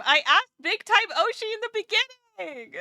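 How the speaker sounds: excited protest. Real, not acted. Streamer reminding chat she asked first, excited. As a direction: burst out in eager protest.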